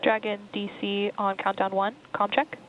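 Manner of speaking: crackly radio comm check; astronaut radio. Real, not acted. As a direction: speak as if over a headset radio.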